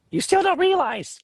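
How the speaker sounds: drawn-out high bird voice, half-laughing. Acted.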